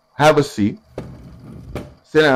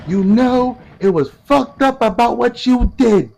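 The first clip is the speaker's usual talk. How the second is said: much higher, slow, aggrieved woman's voice; Peach complaining; 11 semitones higher, 3 dB louder, slower than usual. Retold or imitated. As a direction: drag out the old grudge in a high whine. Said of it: "Hurricane Peach start hopping on to ten years ago and talking about".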